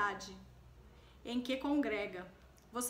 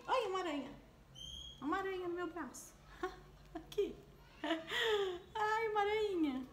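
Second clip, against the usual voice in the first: shaking off a spider; 7 semitones higher than usual. Real, not acted. Spider crawls on her arm.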